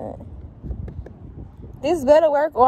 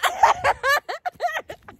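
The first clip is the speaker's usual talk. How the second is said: high burst of laughter. Real, not acted. Teasing friend on camera, high laugh. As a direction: crack up in a high giggle.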